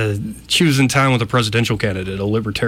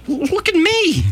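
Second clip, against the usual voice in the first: whiny self-pity; slower than usual. Retold or imitated. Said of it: "I'm looking at some of the other guys going".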